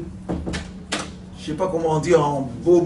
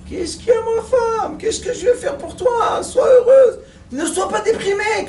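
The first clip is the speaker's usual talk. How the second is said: Jojo consoling wife; 14 semitones higher than usual. Acted. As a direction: beg her to cheer up, squeaky and frantic. Jojo consoles his crying wife. (mari suppliant, voix aiguë et forte)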